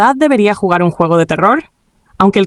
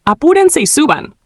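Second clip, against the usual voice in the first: retold excited cry, pitched up; excited call; 8 semitones higher than usual. Gamer hurrying the others up, excited. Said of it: "gritó emocionado".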